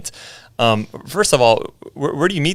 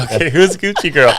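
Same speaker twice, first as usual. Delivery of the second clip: surprised; 6 semitones higher, 5 dB louder than usual. Retold or imitated. Incredulous reaction to video.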